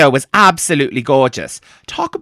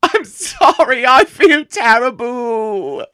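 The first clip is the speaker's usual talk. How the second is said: high, slow, drawn-out wail; hammed-up tearful apology; 3 semitones higher, much slower than usual. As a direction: whine it out, stretching every word. Fan breaking down after being scolded.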